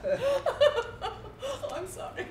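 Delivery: words broken by high laughter; laughing. Acted. Actress apologising to the prince while still laughing. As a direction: speak through the giggles, slowly at first. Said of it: "I interpret as laughing".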